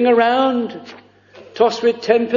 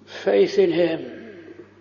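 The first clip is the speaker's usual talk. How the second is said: normal talking voice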